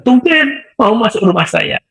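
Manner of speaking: high, slow, astonished exclamation; the neighbour exclaims that he wants to enter her house; surprised cry. Retold or imitated. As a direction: cry out in disbelief that he came in. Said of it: "beliau teriak tumben itu".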